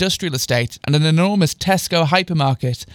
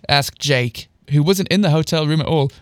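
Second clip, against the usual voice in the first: plain reading voice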